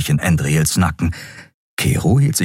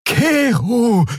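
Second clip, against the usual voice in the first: high, drawn-out shout of the name; urgent, pleading cry; alarmed cry. A narrator's reading. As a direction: call out to stop the blow. Xea cries out to stop the blow.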